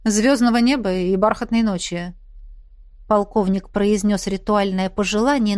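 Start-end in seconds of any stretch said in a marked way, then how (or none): none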